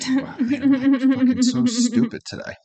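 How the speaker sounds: high, laughing self-mockery; laughing at himself. Real, not acted. Host laughing at himself for skipping a lyric, amused. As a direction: crack up at your own mistake.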